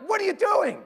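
high mock shout of a startled mother; mom yelling. Mom shouting at her son in the bleachers, high and loud. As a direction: yell it up at the runaway kid. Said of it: "my mom's like".

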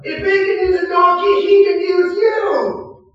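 preaching shout. Real, not acted. Preacher rallying congregation that God uses anyone, preaching shout. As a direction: drive the point home.

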